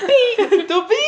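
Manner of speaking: high loud bleep noise over the swear; censor bleep. Pretend. Joking self-censorship for the recording, high bleep. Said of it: "Censura, tía".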